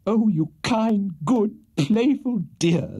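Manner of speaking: high, slow, swooning adoration; rapturous gushing. A narrator's reading. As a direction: gush each endearment, drawn out and high. Smitten widow gushes at Pickwick.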